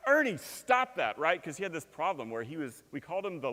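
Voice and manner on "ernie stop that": high, loud scolding of the dog; yelling at the dog. Retold or imitated. Family yelling at their licking dog, loud scold. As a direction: snap at the dog to stop. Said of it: "much yelling, right".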